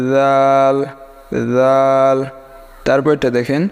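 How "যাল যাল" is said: দাল starting with a soft voiced th, held long; soft dhal. Shown as a demonstration. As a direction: lisp the first sound of দাল gently. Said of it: "এটা নরম করে উচ্চারণ হবে". (নরম করে টেনে যাল)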